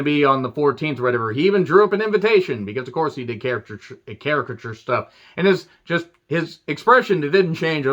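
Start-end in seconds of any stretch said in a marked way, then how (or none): none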